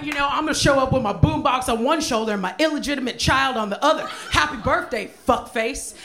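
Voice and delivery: high, loud, laughing sarcastic rage; hammed-up fury, shouted through laughter; furious mock rant. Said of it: "I got, like, so angry".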